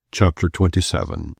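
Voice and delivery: ordinary delivery, nothing marked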